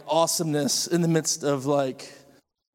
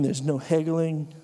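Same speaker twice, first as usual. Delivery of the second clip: ordinary delivery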